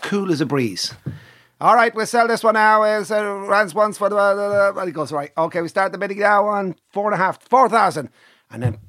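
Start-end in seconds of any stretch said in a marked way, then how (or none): 1.6-8.1 high monotone auction patter; auctioneer. Acted. Auctioneer starting bids on the next horse, high chant. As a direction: chant the opening bid to the ring.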